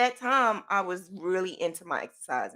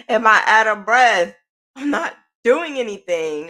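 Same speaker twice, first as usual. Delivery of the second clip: louder, higher baffled self-questioning; mock-indignant bafflement, pushed louder; retold exasperation; 3 semitones higher, 7 dB louder than usual. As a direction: ask yourself in exasperated disbelief. Retold puzzled outburst at herself.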